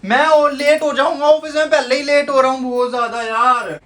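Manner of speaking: high, loud wail of complaint; frustrated protest. Real, not acted. Man held back from office protesting he is late, frustrated. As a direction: complain loudly, pitch way up.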